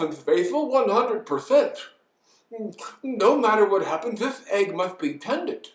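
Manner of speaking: loud, building elephant vow; determined elephant. Acted. Lonely elephant continues his vow, loud resolve. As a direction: insist loudly, rising as you go.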